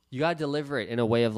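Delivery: usual speaking voice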